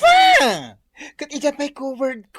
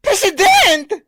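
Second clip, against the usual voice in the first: surprised; 8 semitones higher, 6 dB louder than usual. Real, not acted. Shocked about the President.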